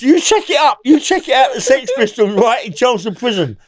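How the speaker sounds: normal talking voice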